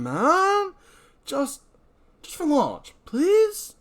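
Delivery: put-on pleading young guy. John begs old guard for favor.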